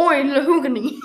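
loud, hammed-up Australian, breaking into a laugh; playful exaggerated Australian accent. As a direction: put on a big mock Aussie shout. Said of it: "turning into an Australian".

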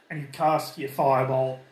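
ordinary talk

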